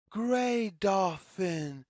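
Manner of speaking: ordinary delivery, nothing marked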